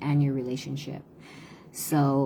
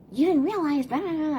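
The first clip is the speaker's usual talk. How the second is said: much higher, whiny mimic of a complaining child; mocking whiny kid; 12 semitones higher than usual. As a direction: parody the kid droning on and on.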